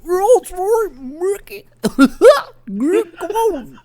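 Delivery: silly cartoon dog ham; Goofy voice. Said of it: "And he's like".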